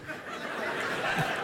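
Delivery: breathless, soundless laughter; silent wheezing laugh. Real, not acted. Preacher cracking up at his son's reply, soundless laugh.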